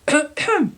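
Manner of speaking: high squeaky throat clearing; nervous throat clear. Acted.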